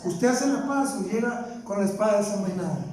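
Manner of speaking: normal talking voice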